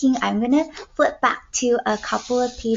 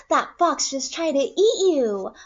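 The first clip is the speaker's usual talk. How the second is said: ordinary talk, nothing marked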